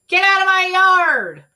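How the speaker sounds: high, loud furious shout; angry shout. Acted. Eugenia chasing Mercy out of her yard, shouting. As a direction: chase the pig off in fury. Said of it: "shouted Eugenia".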